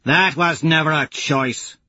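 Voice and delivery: a hint of a hard warrior woman; calling up to Lorn, unyielding; Aja calling. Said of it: "Aja calls".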